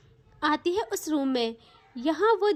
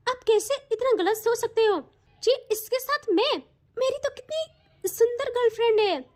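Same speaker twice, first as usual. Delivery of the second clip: higher, put-on innocent protest; defensive excuse; 6 semitones higher than usual. Siya protesting to the aunts, put-on innocent indignation. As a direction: fake indignation to cover up.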